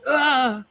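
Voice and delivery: short high, loud "ah" of fright; startled cry. Retold or imitated. Startled woman stopped on her way back, high yelp. Imitated gasp of startled character.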